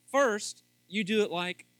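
loud, high, building sermon; preaching. Real, not acted. Pastor preaching to husbands at full force. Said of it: "I'm getting a little preachy there".

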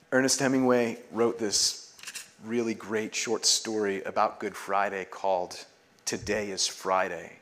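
normal talking voice

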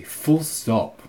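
normal talking voice

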